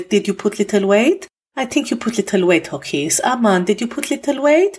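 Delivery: light Armenian granny voice, teasing; Mema's Armenian accent. Retold or imitated. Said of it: "I'm gonna do an accent now".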